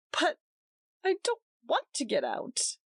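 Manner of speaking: a touch of daze; dazed. A narrator's reading. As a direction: say it stunned. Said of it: "she said dazedly".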